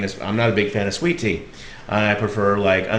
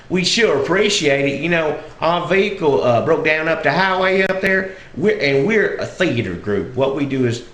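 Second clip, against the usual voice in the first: Southern dialect; 6 semitones higher than usual. Retold or imitated. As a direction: explain politely in country Southern dialect. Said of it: "I was using that dialect".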